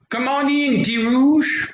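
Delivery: very high falsetto wolf posing as mama; wolf badly faking mama, stretched and high; funny disguised voice. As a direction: call out in a high, fake granny voice. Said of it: "maybe her voice sounds funny".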